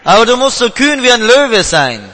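ordinary talk, nothing marked

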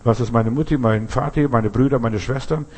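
ordinary talk

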